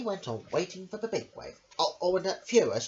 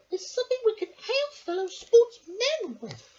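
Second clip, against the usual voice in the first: much higher, squeaky little lady voice; Missus Armitage voice; 6 dB louder than usual. Acted. Missus Armitage asks her dog a question, high and squeaky. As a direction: pipe it up like a fussy little lady. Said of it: "said Missus Armitage".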